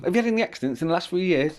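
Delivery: quick, high-pitched doorstep patter; sales pitch. Retold or imitated. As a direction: imitate a rattled-off cold-approach sales line. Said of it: "I can't remember my pitch now".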